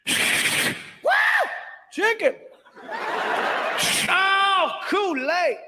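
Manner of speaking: high, delighted cries over each item; excited unpacking. Retold or imitated. Friend opening the basket, delighted and high. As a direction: gush over every treat.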